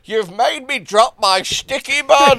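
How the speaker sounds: high, slow outraged voice; indignant posh driver. Retold or imitated. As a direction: play a huffy driver. Plays the annoyed driver.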